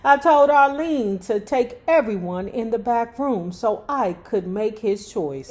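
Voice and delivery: ordinary talk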